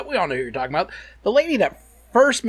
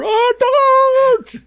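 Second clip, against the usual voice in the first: high, loud hammed-up darts fan whoop; hammed-up stadium whoop from the stands; mock fan cheer; 8 dB louder than usual. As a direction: cheer like a drunk fan in the crowd.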